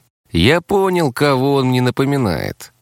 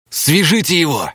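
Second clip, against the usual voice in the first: crisp raised order; commanding; 7 semitones higher than usual. A narrator's reading. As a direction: give the order to the men. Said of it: "скомандовал". (командным тоном)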